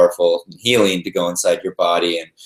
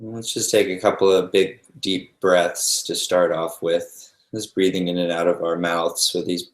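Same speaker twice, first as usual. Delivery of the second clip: calm meditation guide with long, restful pauses; guided meditation. Real, not acted. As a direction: ease them gently into noticing the breath, unhurried.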